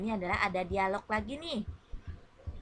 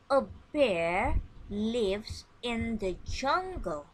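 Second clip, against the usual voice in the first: slow model sentence; slower than usual. Shown as a demonstration. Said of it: "Listen and repeat after me".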